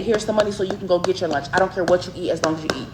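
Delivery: normal talking voice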